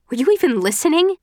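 high, loud exasperated snap. Acted. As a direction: demand it, fed up. Exasperated with Will.

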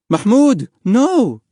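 high, loud motherly shout of alarm; alarmed cry. A narrator's reading. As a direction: cry out to stop your child. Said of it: "his mother cried".

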